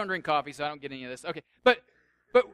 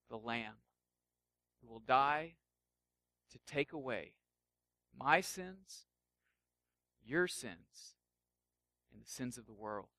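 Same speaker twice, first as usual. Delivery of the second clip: softer and lower, with weighty pauses; hushed solemn reveal; 7 semitones lower, 8 dB quieter than usual. Acted. Jesus telling followers he is also the lamb, hushed and solemn. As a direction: drop to a quiet, grave declaration.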